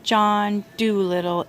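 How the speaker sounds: ordinary delivery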